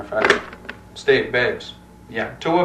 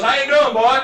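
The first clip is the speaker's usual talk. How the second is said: playful mock-southern lawman, pitched up; southern accent; 8 semitones higher than usual. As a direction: put on a state trooper's southern accent. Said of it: "you develop a southern accent".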